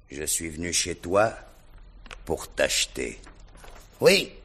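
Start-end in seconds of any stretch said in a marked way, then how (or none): 4.0-4.3 louder and higher, gloating; triumphant boast to buy him. Acted. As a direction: crow over him, louder. Rogojine offers to buy Gania.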